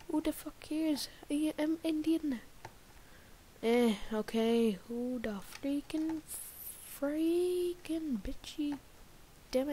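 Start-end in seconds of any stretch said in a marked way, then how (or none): none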